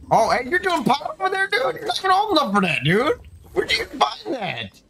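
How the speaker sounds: high, mock-shocked scolding; mock outrage. Scolding his son's character in a high mock-shocked voice. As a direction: play the shocked parent, high.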